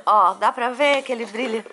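normal talking voice

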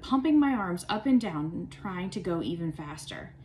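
plain reading voice

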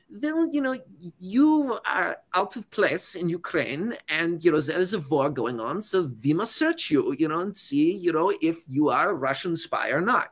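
meticulous, deliberate villain voice, each word placed; Vlad as Bond villain. Retold or imitated. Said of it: "like, Bond villain type mannerisms".

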